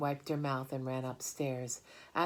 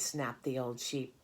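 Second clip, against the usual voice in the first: plain reading voice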